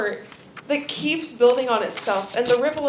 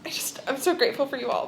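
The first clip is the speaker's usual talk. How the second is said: ordinary delivery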